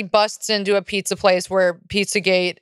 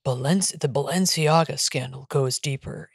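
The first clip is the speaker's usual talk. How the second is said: low, hushed mock-ominous TikTok narrator; spooky reveal, half joking; ominous conspiracy voice; 6 semitones lower, 4 dB quieter than usual. Mocking a TikTok post in a low ominous voice.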